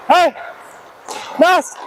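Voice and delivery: short, high shouts across the rink; calling out. Real, not acted. Player calling to a teammate mid-drill, shouting. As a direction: yell to get a teammate's attention.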